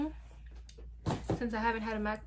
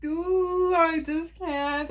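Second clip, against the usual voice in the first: overwhelmed; 5 semitones higher, 7 dB louder, much slower than usual. Real, not acted. Overwhelmed by new laptop.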